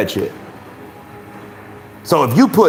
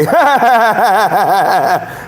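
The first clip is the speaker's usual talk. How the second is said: long, high burst of laughter; real laugh; 6 semitones higher than usual. Real, not acted. Host amused at the champion put to sleep, laughing.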